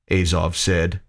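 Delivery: ordinary delivery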